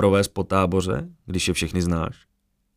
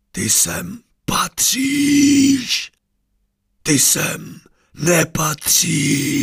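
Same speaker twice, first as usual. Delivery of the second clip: very slow, high, otherworldly chant; uncanny drawn-out voice echoing from everywhere; voice from all sides; 9 semitones higher, 4 dB louder, much slower than usual. A narrator's reading. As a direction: stretch every word like an echoing spirit. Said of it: "Ozývalo se ze všech stran a zároveň i z jeho úst".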